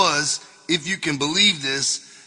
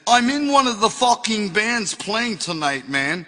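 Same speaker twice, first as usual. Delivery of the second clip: a bad put-on English accent, pitched up; mock Brit, hammed and sloppy; shitty English accent; 5 semitones higher than usual. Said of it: "that was my, uh, shitty English accent".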